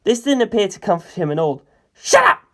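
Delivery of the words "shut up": high, loud furious roar; high, loud enraged yell. A narrator's reading. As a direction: bellow it at them. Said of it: "he roared".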